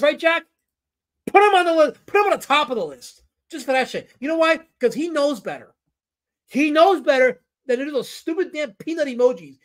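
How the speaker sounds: high, loud exasperated rant; mock outrage. Streamer orders Jack to list an offender, hammed-up outrage. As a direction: rail at the offender, voice pitched right up.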